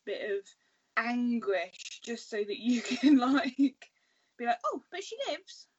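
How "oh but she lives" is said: high, light teasing voice; lightly voiced smug writer reassuring fans; mock showrunner tease. Writer teasing the audience, lightly mocking and high. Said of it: "That was cute. I like that little".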